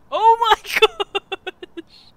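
loud laughing shriek; cracking up mid-word; laughing hard. Real, not acted. Bursts out laughing at teammate.

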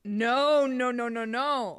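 insistent, punched refusal at high pitch; emphatic denial. Real, not acted. Co-host rejects the idea of staying there, emphatic denial.

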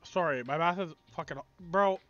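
ordinary talk

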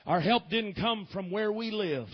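ordinary delivery

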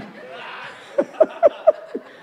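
squealing unvoiced laugh; high wheezing laugh. Real, not acted. The preacher cracks up at his own story, high and wheezing.

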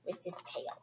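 whispered softly as a little joke; hushed punchline. Acted. Teacher reading to children, whispered punchline. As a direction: drop to a whisper for the tail.

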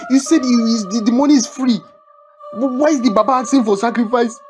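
high, distressed pleading; alarmed, near-tearful questioning; panicked protest. Acted. Learns he is to die.

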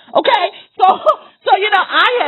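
high laugh breaking into the words. Real, not acted.

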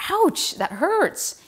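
high, slow, drawn-out cry of pain, re-enacted; retold pained ouch. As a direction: stretch the ouch up high like it really hurts. Shattered collarbone, jersey yanked.

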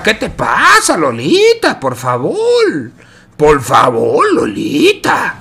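high, slow desperate plea; pleading despair. Real, not acted. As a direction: beg the player to stop. Caster pleads at a misplay.

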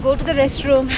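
ordinary talk, nothing marked

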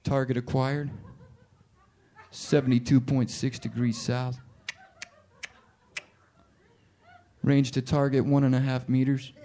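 calm sniper reading out the target coordinates. Pretend. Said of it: "You'd be like".

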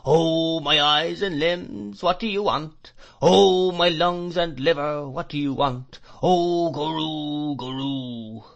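high, slow wail that swells and sinks; droning old man, words stretched like a gust of wind; fierce monotonous whine. A narrator's reading. Old shopkeeper seizing a boy by the hair, fierce monotonous whine. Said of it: "in a fierce monotonous whine".

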